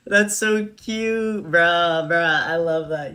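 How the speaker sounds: high, loud gushing over something cute. Real, not acted. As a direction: squeal how cute it is.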